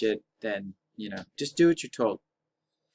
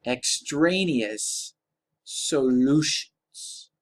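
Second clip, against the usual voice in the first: very slow, drawn-out ominous term; much slower than usual. Shown as a demonstration. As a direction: stretch each word out slowly. Teacher drawls the key term.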